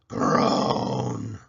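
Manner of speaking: long drawn-out groan of pain; a crocodile groaning in pain; loud groan. A narrator's reading. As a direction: groan as if your jaw aches. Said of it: "a loud groaning sound".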